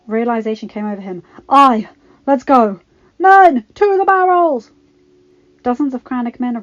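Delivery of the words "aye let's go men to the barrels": loud order climbing high; rally the men to action; forceful cries building to a long yell; rallying shout. Acted. Lord rallies men in battle.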